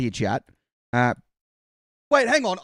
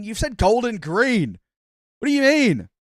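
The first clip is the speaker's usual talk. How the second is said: high, loud, incredulous protest; playfully affronted, pitch leaping up; mock outrage; 6 semitones higher, 4 dB louder than usual. Real, not acted. Streamer protests chat's golden green remark, mock outrage.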